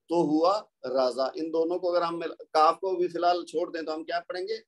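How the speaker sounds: ordinary delivery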